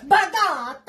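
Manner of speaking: loud, high burst of fake Italian; mock Italian accent. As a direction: blurt it in an overdone Italian accent. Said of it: "then I'm all like".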